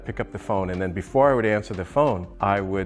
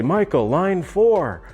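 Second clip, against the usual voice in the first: slow, loud, high announcing call; fake receptionist call; 10 semitones higher, 5 dB louder, much slower than usual. Partner faking a busy office on the phone, slow loud announcing call. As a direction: call out across an imaginary big office. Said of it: "he tried to sound bigger than we were".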